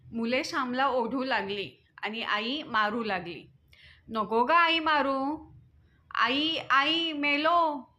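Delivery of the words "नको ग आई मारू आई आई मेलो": drawn-out pleading wail, higher; a child wailing to be spared; crying out. A narrator's reading. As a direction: cry out in slow, stretched-out protest. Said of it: "Shyam ओरडू लागला".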